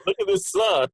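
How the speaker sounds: squealing high, bragging about the weather; bragging about sunny Portland to a friend in Texas, high and thrilled; gushing about the sun. Retold or imitated. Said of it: "It's like".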